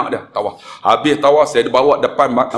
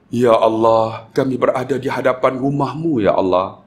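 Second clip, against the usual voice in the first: leading a supplication; 4 semitones lower than usual. Retold or imitated. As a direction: plead to God in a lowered, measured voice. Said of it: "Saya buat tentuhan". (doa merayu, perlahan dan rendah)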